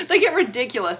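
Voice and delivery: laughing through the words. Real, not acted. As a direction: laugh while you scoff. Laughing at silly sequels.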